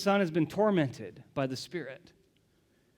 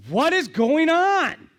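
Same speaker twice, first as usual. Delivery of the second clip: much higher and louder, slow and commanding; booming demand; 12 semitones higher, 11 dB louder, slower than usual. Acted. Jesus cutting through an arguing crowd, booming shout. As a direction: cut through the crowd with your voice. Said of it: "he cuts through the crowd and says".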